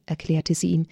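ordinary delivery, nothing marked

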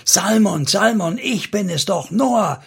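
high, urgent call of his name. A narrator's reading. Noah calls to Salmon while holding his arm, urgent high call. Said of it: "rief ihn an".

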